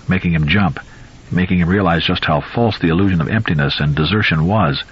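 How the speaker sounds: ordinary delivery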